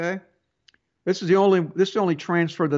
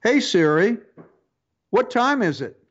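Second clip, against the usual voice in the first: lightly raised voice commanding the phone; talking to Siri; 6 semitones higher than usual. Shown as a demonstration. Host demonstrates his phone, asking Siri the time. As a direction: call out to the phone assistant. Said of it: "I have a British accent on mine".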